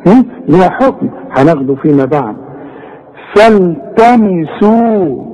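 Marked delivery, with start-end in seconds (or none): 3.3-5.2 فالتمسوا with the final waw held two counts, slow; natural madd. Shown as a demonstration. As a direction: stretch the u in فالتمسوا. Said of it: "شوف السين مضمومة". (مد الواو في فالتمسوا حركتين)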